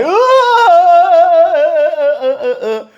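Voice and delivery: strained ahs squeezed very high and loud; wrong stretched-up ah. Shown as a demonstration. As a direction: demo of reaching up for the high notes the wrong way. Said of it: "we're stretching up like".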